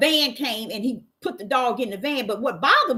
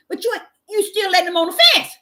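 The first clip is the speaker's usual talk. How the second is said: indignant retort; 10 semitones higher, 4 dB louder, faster than usual. Retold or imitated. Pointed rebuttal.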